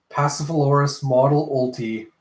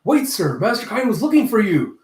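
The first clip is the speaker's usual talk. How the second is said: higher-pitched urgent call; 8 semitones higher, 3 dB louder than usual. Retold or imitated. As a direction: call after a superior. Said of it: "you see someone calling".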